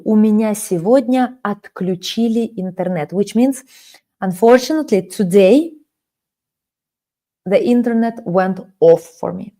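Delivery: normal talking voice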